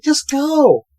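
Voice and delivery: high, frantic squeal; hammed-up desperate order; mock urgency. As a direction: imitate a panicked girl urging someone on. Acts panicked girls urging others on.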